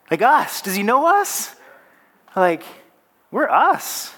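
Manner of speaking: high, incredulous questioning on behalf of the listeners; mock disbelief. Preacher voicing doubt that God knows us, incredulous. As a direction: lift the pitch as if you can't believe it. Voices congregation's disbelief at being chosen.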